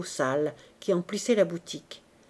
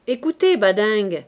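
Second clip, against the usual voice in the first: louder call across the shop; 8 dB louder than usual. Acted. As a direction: call out to Badingue in the back room. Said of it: "cria Lantier". (Lantier interpelle Poisson, appel plus fort)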